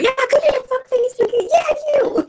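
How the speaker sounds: high-pitched yelling, little real volume; hammed-up angry call across the room; mock screaming. Player as Karina calling out her attacker, mock screaming. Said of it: "She's literally screaming out".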